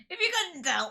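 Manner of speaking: stuffy nasal sick voice. Real, not acted. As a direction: talk with a blocked nose. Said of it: "nasally voice".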